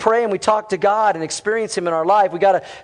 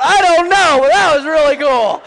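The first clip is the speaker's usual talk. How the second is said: high, loud, clueless enthusiasm; excited churchgoer; 8 semitones higher, 7 dB louder than usual. Pretend. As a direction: play the dazzled congregant, loudly. Imagined congregant answers a friend.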